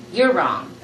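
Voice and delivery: plain reading voice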